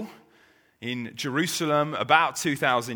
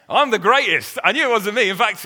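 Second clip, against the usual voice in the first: loud, higher, bragging disciple; boastful shout; 8 semitones higher, 10 dB louder than usual. Retold or imitated. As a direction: crow it out to win the argument. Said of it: "start arguing over who's the best".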